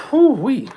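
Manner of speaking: high, loud "Whew-wee" whoop from spicy heat; hot-food whoop. Real, not acted. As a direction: yelp at the burning in the mouth. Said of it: "My fucking mouth is on fire".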